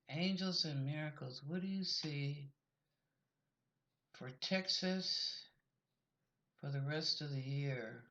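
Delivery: quiet plea to angels and miracles; hushed invocation. Real, not acted. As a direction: drop to a hushed call to the angels. Calling on angels for a reading.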